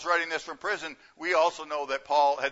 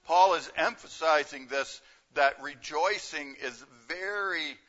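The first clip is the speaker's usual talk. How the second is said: normal talking voice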